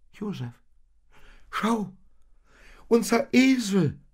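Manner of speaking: high, loud excited cry to Josef; excited call. Acted. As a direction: Maria calls out in alarmed delight. Maria spots the donkey leaving.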